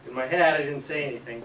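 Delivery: ordinary talk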